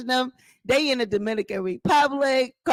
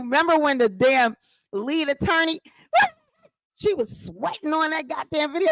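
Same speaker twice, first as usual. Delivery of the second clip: ordinary talk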